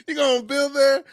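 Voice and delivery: high squeal of a question through laughter; laughing, high-pitched question; laughing disbelief. Real, not acted. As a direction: crack up as you ask it.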